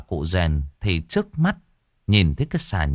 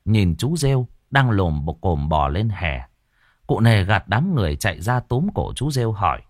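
usual speaking voice

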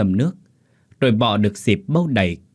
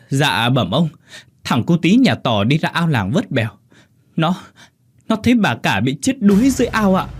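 frantic servant; 6 semitones higher, 4 dB louder than usual. Acted. Servant reports drowning.

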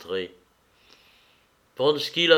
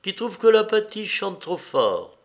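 smiling; 7 semitones higher than usual. Acted. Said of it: "dit-elle en souriant".

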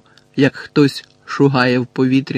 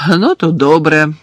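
louder, grudging grumble; grumbling; 3 semitones higher, 7 dB louder than usual. A narrator's reading. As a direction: agree grumpily, with some force. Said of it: "буркотливо сказав Рон".

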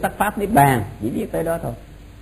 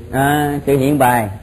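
dictating; 3 semitones lower than usual. Shown as a demonstration. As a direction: spell it out for note-takers. Teacher dictating notes to class.

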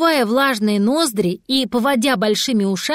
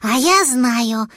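put-on slow, matter-of-fact child voice; nonchalant little dragon; slower than usual. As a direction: say it as if nothing happened. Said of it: "как ни в чём не бывало".

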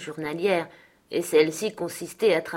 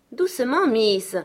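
high-pitched, louder check; admonishing; 6 semitones higher, 5 dB louder, slower than usual. A narrator's reading. Servant tells the young miss off, admonishing. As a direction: rein the young lady in.

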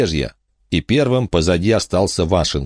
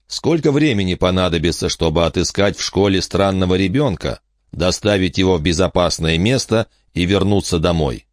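ordinary delivery, nothing marked

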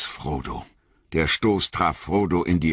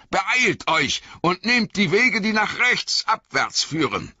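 higher, pressing commands to the fellowship; urgent wizard orders; 9 semitones higher than usual. A narrator's reading. Gandalf commands the company to hurry. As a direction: urge them down the stairs. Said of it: "sagte Gandalf".

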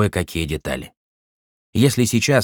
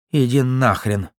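angry snarl; 4 semitones higher than usual. Acted. Beaten cop curses interrogator.

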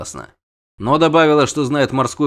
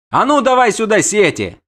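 high, loud command; barked order; 10 semitones higher, 5 dB louder than usual. A narrator's reading. Prince orders the nets brought, barking. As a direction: bark it like an impatient prince. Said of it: "рявкнула главаль".

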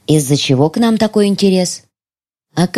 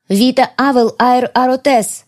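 higher, slow, ringing Вита Авел Аэр Аротес; ancient spell, stronger; 6 semitones higher, slower than usual. Acted. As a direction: call out the spell with power. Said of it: "повторила я". (звонкое заклинание)

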